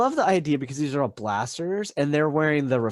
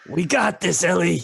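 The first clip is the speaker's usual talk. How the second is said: slightly raised in-character call; mild call to a teammate, no real shout; 3 semitones higher than usual. Acted. Player's character calls out to Ellie mid-fight, mildly calling out. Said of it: "he calls out".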